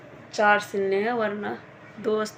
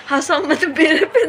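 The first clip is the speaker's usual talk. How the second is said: laughing plea; 6 semitones higher, 10 dB louder, slower than usual. Retold or imitated. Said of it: "ये कह रहा है".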